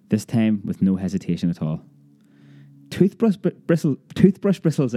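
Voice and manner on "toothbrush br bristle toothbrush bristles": high, stumbling cry of disbelief; startled child crying out the words; excited outcry. A narrator's reading. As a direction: blurt it out, tripping over the words. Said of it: "I cried".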